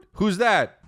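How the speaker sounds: ordinary talk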